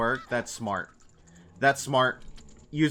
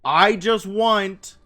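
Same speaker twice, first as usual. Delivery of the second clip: imitating the YouTuber; 5 semitones higher, 6 dB louder, slower than usual. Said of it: "where he's like".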